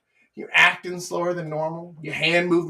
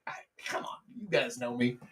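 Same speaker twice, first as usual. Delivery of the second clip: hushed aside; 5 semitones lower, 11 dB quieter than usual. Real, not acted. Aside to viewers who know him.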